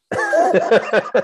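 high, bright burst of real laughter; a sudden high laugh. Real, not acted. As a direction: laugh out loud at the punchline. Host laughs at band quitting.

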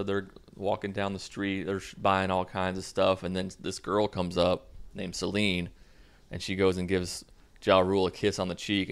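usual speaking voice

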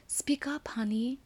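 high, hushed, coaxing teacher voice; first-grade teacher. Retold or imitated. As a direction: imitate a teacher gently prompting a shy little girl. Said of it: "when the teacher would look at a little girl, and she would say to her".

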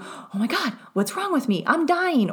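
rising mock panic, up to a high I'm dying. Explaining her panic spiral to viewers, mock frantic. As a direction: play the spiral of panic, half in jest. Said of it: "versus going into".

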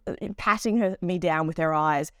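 normal talking voice

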